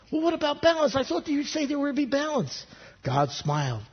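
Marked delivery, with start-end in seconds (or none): 0.1-2.7 high, puzzled questioning voice; puzzled archangel. Acted. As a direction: ask it like a confused angel. Archangel asks about balance.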